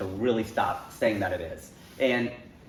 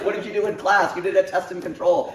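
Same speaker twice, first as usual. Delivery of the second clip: raised, loud, insistent lecture; mock exasperated scolding; 8 semitones higher, 6 dB louder than usual. Comedian sending critics back to science class, loudly exasperated. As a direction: scold them like students who forgot their science.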